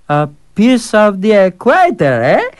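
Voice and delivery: high, amused pondering; musing fool. Acted. Fool musing over the impossible task. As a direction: turn the idea over with a grin.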